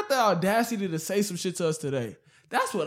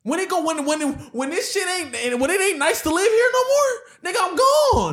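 high, heated declaration rising as it goes; emotional build-up; 6 semitones higher than usual. Real, not acted. Telling friends he would leave the country, emotional build-up. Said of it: "full, you know, emotional build up".

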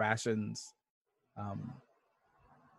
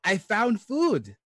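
excited; 9 semitones higher, 6 dB louder, slower than usual. Acted. Proudly announces found food.

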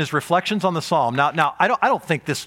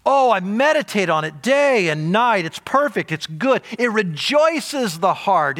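higher, emphatic psalmist praise with stretched key words; rapturous extolling; 5 semitones higher, 3 dB louder than usual. Acted. Preacher voicing psalmists who delight in God's law, rapturous extolling. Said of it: "They just extol the, in weird ways".

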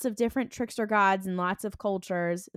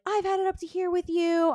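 high, louder exasperated blast; fed-up outburst; 9 semitones higher, 4 dB louder than usual. Retold or imitated. Sam telling his brother he's had enough, exasperated. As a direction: snap as patience runs out.

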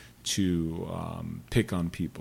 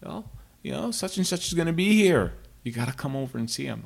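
imitating sister; 6 semitones higher than usual. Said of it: "she's like".

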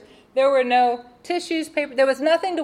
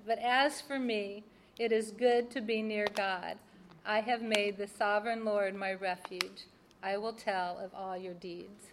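soft, measured reading of a psalm, a little quieter; scripture reading; 2 semitones lower, 8 dB quieter than usual. Acted. Reading her Bible verses to the audience, softly reverent. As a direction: read the verses like a quiet prayer. Reads Psalm seventy-three aloud.

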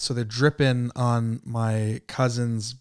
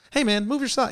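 light high casual voice, no edge; easygoing nudge, nothing aggressive; casual friendly ask; 10 semitones higher than usual. Retold or imitated. Cousin politely asking about the socks, easygoing.

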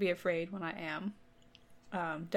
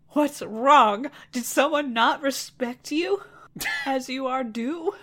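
loud, higher, over-the-top worried prince; loud dramatic concern; 5 semitones higher, 7 dB louder, slower than usual. Acted. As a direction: boom out the worried question. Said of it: "he says to her".

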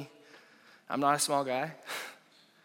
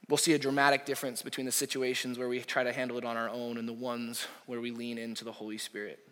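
ordinary delivery

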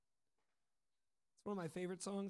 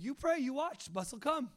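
higher and louder, insistent; firm instruction; 6 semitones higher, 5 dB louder than usual. Retold or imitated. Saul instructing the group to pray for the bus, firm and loud. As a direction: lay down the rule with confidence. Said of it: "He's like".

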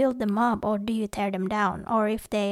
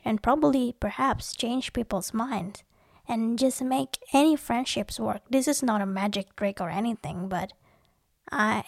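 usual speaking voice

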